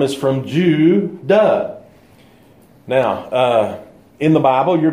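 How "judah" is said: "Judah" broken slowly into Ju and dah; syllables split. Shown as a demonstration. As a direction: stretch the Ju in "Judah", pause, then dah.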